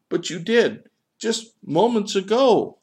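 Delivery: loud, very high protest; high, incredulous outburst; bewildered insistence. Acted. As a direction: insist in baffled disbelief. Bewildered gull argues back with child.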